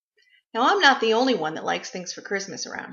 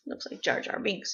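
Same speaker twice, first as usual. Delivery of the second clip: whispered aside; 3 semitones lower, 12 dB quieter than usual. Real, not acted. Whisper aside about pet.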